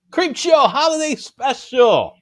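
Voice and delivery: high, loud, drawn-out put-on voice; theatrical title announcement. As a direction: ham up the big title, stretching each word. Announces the week's big title theatrically.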